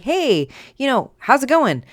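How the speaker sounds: high-pitched, hammed-up breezy hello; mock casual greeting. Brother mocking a chummy approach to a general, mock casual. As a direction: imitate someone far too chummy with a superior.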